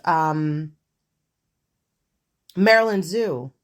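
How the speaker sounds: ordinary talk, nothing marked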